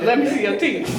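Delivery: ordinary talk, nothing marked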